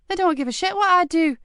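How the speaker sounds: a cheeky lad asking keenly; young boy voice. A narrator's reading. Mickey asks Marcus eagerly, in a high young boy's voice.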